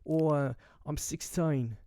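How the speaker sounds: put-on botched, drawn-out German accent; clumsy imitation of a German accent. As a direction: attempt a German teen's accent, slowly. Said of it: "with their German accents".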